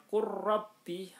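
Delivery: "qurrabbi" recited high and loud with a long rolled r; merged lam into ro. Shown as a demonstration. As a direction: chant "qurrabbi" with no l and a doubled trilled r.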